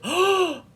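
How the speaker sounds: high, loud, dramatic gasp; mock collective gasp. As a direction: gasp like a whole shocked cast. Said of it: "everyone gasped again".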